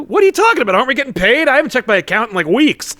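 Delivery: high, loud opening, settling as it goes; acted baffled outburst, easing off; mock indignant disbelief. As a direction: protest like someone suddenly worried about pay. Acted Falcon panics about pay.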